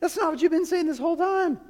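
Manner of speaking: a startled "what on earth" at Jesus; baffled outburst. Retold or imitated. Preacher retells his own reaction reading Jesus, mock baffled. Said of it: "and I was like".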